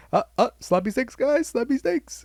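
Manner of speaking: rallying the guys with a silly catchphrase; mock catchphrase. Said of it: "just be like".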